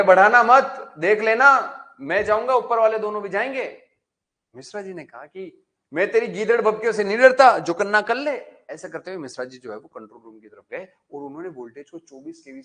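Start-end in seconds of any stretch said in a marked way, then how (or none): 0.0-3.8 higher, much louder yelled warning; loud warning shout. Acted. Insulator yells a warning to Mishra ji, high and loud. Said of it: "ये भी बोला जोर से". 5.9-8.4 higher, louder scornful retort; defiant shout. Acted. Mishra ji scorns the insulator's threats, loud.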